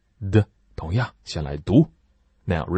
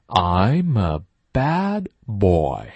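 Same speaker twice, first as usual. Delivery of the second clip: slow, stressed model sentence; slow teacher model: I'm a bad boy; slow model reading; much slower than usual. Shown as a demonstration. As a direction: read it slowly, leaning on bad. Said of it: "model sentence for repeating".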